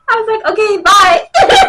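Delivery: loud, high, through real giggles; cracking up. Real, not acted. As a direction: squeal it while breaking into laughter. Laughing at her own goodbye.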